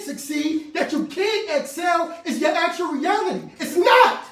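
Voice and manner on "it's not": high loud it's not; emphatic shout. Real, not acted. Preacher denying false reality, loud high shout. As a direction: slam the denial.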